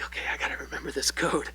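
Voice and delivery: quick, soft, almost whispered reminder; muttering a code to remember it; hushed to himself. Retold or imitated. David memorizing the signal code.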